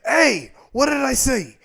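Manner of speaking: loud, high, slow angry scold. Acted. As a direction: snap at him like a furious wife. Mother scolds husband over Oreos.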